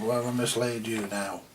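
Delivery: ordinary talk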